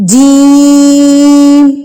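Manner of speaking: drawn-out recitation of جیم; long drawn letter. Shown as a demonstration. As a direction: chant جیم slowly like a Qaida teacher. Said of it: "تین سے چار حرکات کے برابر اس کو کھینچ".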